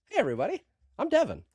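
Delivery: very high, sing-song mascot greeting; jerky animatronic voice. Retold or imitated. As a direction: chirp it like a Chuck E. Cheese robot. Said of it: "like you should go work for Chuck E. Cheese".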